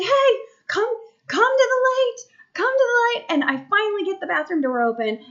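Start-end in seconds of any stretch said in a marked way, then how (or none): 0.0-3.3 high, loud retold holler; calling out. Mom guiding sleepwalker toward the light, high holler. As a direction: urge the child over, pitched way up. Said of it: "I'm like".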